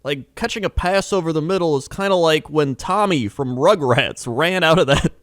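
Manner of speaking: put-on high, bright-eyed kids' TV announcer. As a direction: sell it like a Nickelodeon host talking to kids. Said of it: "They're like".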